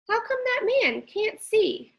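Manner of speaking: lightly voiced young boy; child voice. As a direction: ask it like a curious child. CJ asks about blind man.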